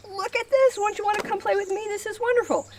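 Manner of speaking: very high, fast, delighted coaxing; enticing puppy talk. Shown as a demonstration. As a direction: make the dog think it's a party. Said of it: "catch the eye of that dog and say".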